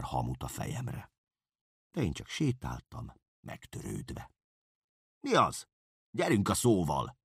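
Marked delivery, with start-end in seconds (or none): 5.2-7.2 high, loud hurry-up call; startling demand to get on with it; urging shout. Acted. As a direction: snap him into speaking. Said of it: "riasztott rám Kerekes úr".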